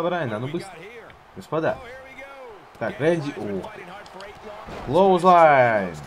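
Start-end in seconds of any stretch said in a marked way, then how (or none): 4.9-5.9 loud, higher move call; announcing shout. Acted. Calls move name.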